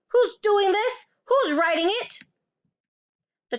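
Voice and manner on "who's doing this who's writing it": high, loud alarmed demand; rattled, angry outburst; yelled. A narrator's reading. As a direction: yell at nobody in particular. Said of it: "Then she yelled".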